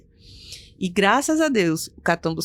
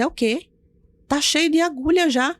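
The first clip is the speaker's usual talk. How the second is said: indignant disbelief; 7 semitones higher than usual. Retold or imitated. She protests to the nurse.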